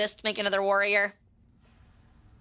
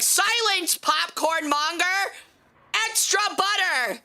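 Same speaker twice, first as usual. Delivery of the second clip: mock grand command; 7 semitones higher than usual. Customer shouts at cashier.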